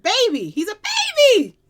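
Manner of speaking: loud excited exclamation, pitch leaping up; getting excited. Real, not acted. Singer's fan reacting to what she just heard, excited. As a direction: burst out with delight. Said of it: "I'm getting excited".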